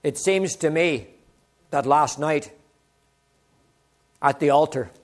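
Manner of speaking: a hint of a Polish preacher, deliberately paced; Polish accent. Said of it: "he looks at me in his Polish accent".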